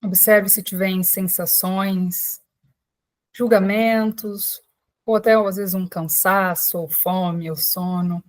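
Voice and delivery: unhurried calming voice with long pauses; guided meditation. Real, not acted. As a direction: guide listeners gently into breath and body, leaving space after each phrase. Leads a guided breathing meditation.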